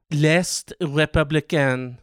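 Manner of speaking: slow, drawn-out French pronunciation; better French accent. Shown as a demonstration. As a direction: savour L'Est Républicain in a French accent. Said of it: "Ooh, that one was way better".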